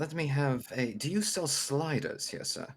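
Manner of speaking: high, loud little gnome with a grand manner; tiny gnome customer. Acted. Gnome asking a vendor for sliders, high and grandly put. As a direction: pipe up high and ceremonious.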